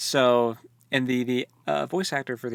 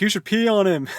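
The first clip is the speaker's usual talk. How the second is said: higher, louder crude taunt; mock crude jerk; 8 semitones higher, 7 dB louder than usual. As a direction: egg them on like a lout. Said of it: "one of 'em was even like".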